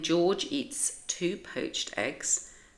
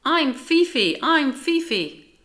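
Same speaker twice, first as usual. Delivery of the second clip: high, louder, eager little dog voice; small dog Fifi; 10 semitones higher, 8 dB louder than usual. A narrator's reading. Tiny dog Fifi introduces herself to Rosie, high and eager. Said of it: "says the small dog".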